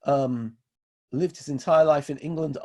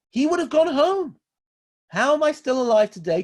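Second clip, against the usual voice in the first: high, loud burst of conviction; impassioned exclamation; 10 semitones higher, 7 dB louder than usual. Real, not acted. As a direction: cry it out as the sermon's climax. Preacher's impassioned climax.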